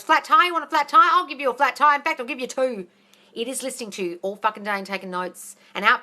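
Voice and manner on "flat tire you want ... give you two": a louder, higher mock-menacing voice of the mind; mock threatening subconscious. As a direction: tease it like a spiteful genie granting the wish. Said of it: "And it goes".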